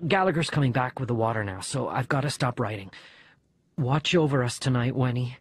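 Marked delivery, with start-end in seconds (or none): none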